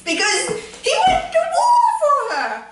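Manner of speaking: high, half laughing half crying. Real, not acted. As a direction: overcome with giddy emotion, laughing through tears. Overwhelmed gushing about Fitz.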